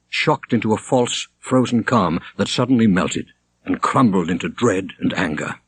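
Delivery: usual speaking voice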